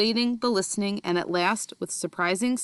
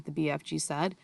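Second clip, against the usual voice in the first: usual speaking voice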